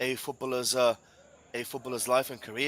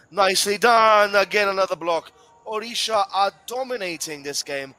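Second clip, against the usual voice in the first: excited commentary; 6 semitones higher, 8 dB louder than usual. Real, not acted. Goal-mouth action, commentator erupts.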